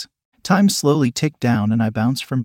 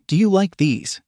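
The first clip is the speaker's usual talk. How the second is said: higher, mildly nervous question; 7 semitones higher than usual. A narrator's reading. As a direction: ask with a touch of nerves, pitched up. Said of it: "My voice cracked from nervousness".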